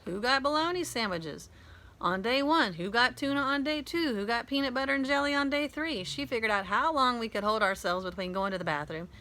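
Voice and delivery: ordinary delivery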